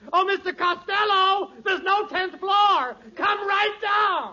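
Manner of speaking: high, loud and slow yelling up; shouting up the stairs in alarm. Acted. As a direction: call out in panic. Clerk yells up in alarm.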